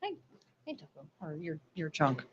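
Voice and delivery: normal talking voice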